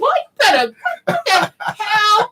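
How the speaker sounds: high, slow, laughing what the hell; sassy disbelief. Retold or imitated. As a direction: tease him in mock outrage. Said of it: "I said".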